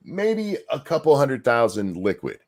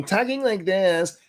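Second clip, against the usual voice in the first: put-on higher-pitched voice; Dylan Mulvaney; 7 semitones higher, slower than usual. Host showing how someone talks when faking a voice, high mocking impression. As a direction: mimic an affected, lifted voice. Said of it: "It's, it's like Dylan Mulvaney".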